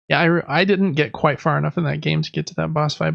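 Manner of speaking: ordinary talk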